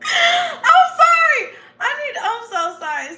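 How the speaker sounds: squealing with laughter, loud and high; can't stop laughing while apologizing; high-pitched laughing apology. Real, not acted. Apologizing to viewers, helplessly laughing. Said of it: "I laugh too much".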